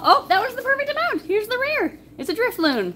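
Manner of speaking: loud, high burst of excitement; thrilled, voice shooting up; excited discovery. Real, not acted. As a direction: exclaim it like a lucky find. Finds rare card in pack.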